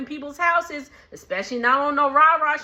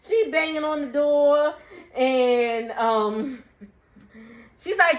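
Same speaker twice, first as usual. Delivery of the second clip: ordinary delivery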